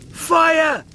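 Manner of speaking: loud, high alarmed shout of fire; panicked shout. Acted. Lister yells as the bunk burns, panicked shout. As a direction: scream it to raise the alarm.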